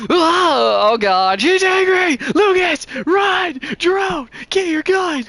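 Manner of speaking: high, loud frantic shouts; terrified yelling orders to run; panicked screaming. Acted. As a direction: scream for help while fleeing the banshee. Banshee rages, character panics.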